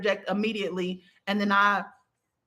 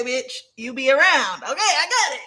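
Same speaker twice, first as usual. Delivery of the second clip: higher, playful mock indignation; sassy comeback; 4 semitones higher than usual. Dreamer answering the guide who proved herself, sassy. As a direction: concede with rising, laughing sass.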